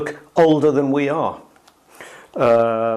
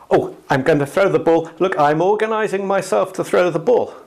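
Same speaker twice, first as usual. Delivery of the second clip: higher, mildly hammed-up self-conscious thrower; mock self-talk; 6 semitones higher than usual. Teacher mocks someone preparing to throw a ball, higher eager voice. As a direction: spell out each step like a fussy athlete. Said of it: "we do throw and catch balls".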